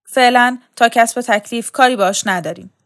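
loud, gruff growl at the guards. A narrator's reading. Soldier stamps his heel and growls his refusal, loud. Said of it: "پاشنه بر زمین کوبید و غرید".

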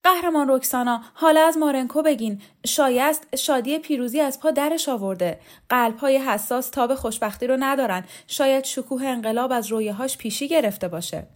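high, loud, strained nervous student; a student clears his throat but his voice still cracks; hoarse trembling voice. Acted. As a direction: push the words out high and shaky with nerves.

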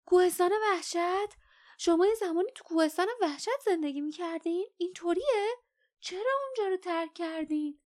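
high, astonished rush of questions; eager interruption. A narrator's reading. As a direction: butt in with high-pitched, excited questions. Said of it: "میان حرف او پرید و گفت".

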